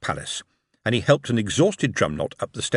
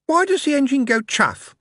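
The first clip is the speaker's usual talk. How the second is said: put-on small boy voice; 4 dB louder than usual. A narrator's reading. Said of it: "The smaller boy".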